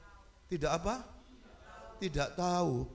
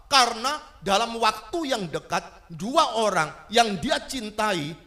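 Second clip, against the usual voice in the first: loud, high preaching voice, driving each question home; forceful preaching; 7 semitones higher, 7 dB louder than usual. Acted. Preacher pressing the congregation about prayer, loud and forceful.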